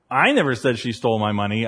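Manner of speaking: "I" singled out as the wrong person; stress on I. Shown as a demonstration. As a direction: put the weight on the first "I". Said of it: "I had somebody else go and report it".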